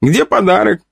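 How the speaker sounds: put-on high, eager child's question; little girl voice. A narrator's reading. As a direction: ask like an impatient little girl. Child asks for her present.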